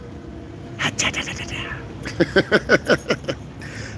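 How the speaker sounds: soft unvoiced ha-ha-ha run; mocking breathy giggle; breathy cackle. Real, not acted. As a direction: laugh it off in quick breathy bursts.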